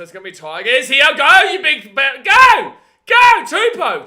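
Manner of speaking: high, full-volume urging; frantic cheering shout. Real, not acted. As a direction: yell the runner on, stretching the last call. Urging on a breakaway run live.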